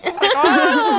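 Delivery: high, loud, slow laughing squeal; laughing shriek. Real, not acted. Laughing shock at the Brunei story.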